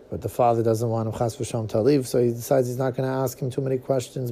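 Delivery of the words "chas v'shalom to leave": usual speaking voice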